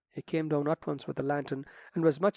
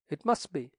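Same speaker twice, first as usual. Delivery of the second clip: ordinary delivery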